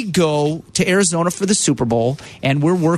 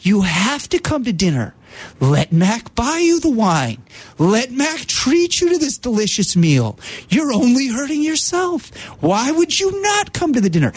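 J D's calming high voice; 7 semitones higher than usual. Retold or imitated. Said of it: "that calming, high voice".

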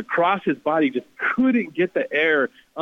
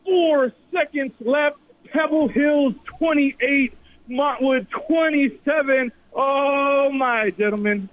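thrilled; 11 semitones higher, slower than usual. Real, not acted. Announcer after the buzzer-beater.

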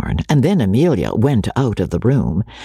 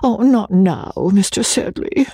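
high, sighing ladylike refusal; acted feminine sigh, pitched up; 9 semitones higher than usual. A narrator's reading. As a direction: demur with a wistful sigh. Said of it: "said Rebecca with a sigh".

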